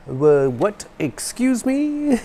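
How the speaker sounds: imitating a confused caller, pitched far up; mock-bewildered, high and slow rising voice; baffled caller. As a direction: draw out a high, puzzled question. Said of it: "people couldn't understand me".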